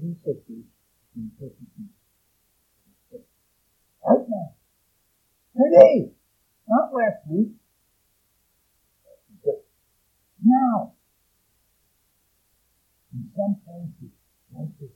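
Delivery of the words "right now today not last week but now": loud, high, impassioned sermon; full-force preaching. Real, not acted. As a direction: thunder it at the congregation.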